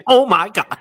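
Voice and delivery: high, loud, stretched-out mock gasp; Chandler Bing style. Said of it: "Chandler Bing style".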